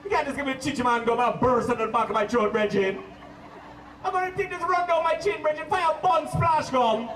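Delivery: loud, high Jamaican outburst; shocked Jamaican. Retold or imitated. Friend bites syrup gum, loud high Jamaican outburst. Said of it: "his eyes popped open, and he's like".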